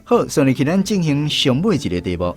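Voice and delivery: ordinary talk, nothing marked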